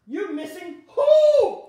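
slow, loud drawn-out who; emphatic preaching call. Real, not acted. Preacher calling to the congregation, slow and loud. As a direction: hit the who hard and slow.

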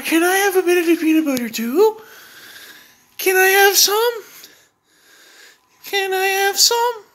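high, loud pleading pet voice. Real, not acted. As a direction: beg the dog in a squeaky high coo. Begging the dog for peanut butter.

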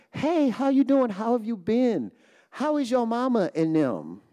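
high, bright mock hello to an old friend; cheerful greeting. Bumping into an old school friend at the grocery store, cheerful high greeting. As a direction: greet them like you're thrilled to see them. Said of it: "you might say to them".

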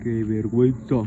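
low, jokey put-on voice. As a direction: repeat it dropped down low for a joke. Repite con voz puesta. (voz grave fingida, en broma)

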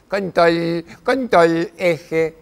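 put-on high, loud little-girl chant; a small child parroting her sister; Spanish: voz de nena chiquita; toddler's imitation. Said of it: "me hace".

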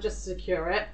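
normal talking voice

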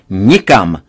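loud with a hard falling finish; final refusal. A narrator's reading. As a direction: slam the word down to close it. Father forbids India trip.

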